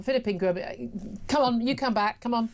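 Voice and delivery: normal talking voice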